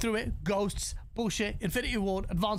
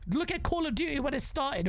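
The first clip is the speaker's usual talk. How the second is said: ordinary talk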